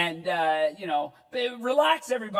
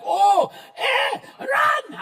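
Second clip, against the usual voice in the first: high, loud panicked yell in a hammed-up Mexican accent; mock Mexican accent; 14 semitones higher, 6 dB louder, much slower than usual. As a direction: play a fleeing worker in an overdone Mexican accent. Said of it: "They're like".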